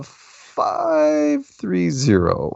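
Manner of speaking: host drags out five three zero to the listeners, slow and stretched; drawn-out call-in number. Real, not acted. Host announcing caller's area code.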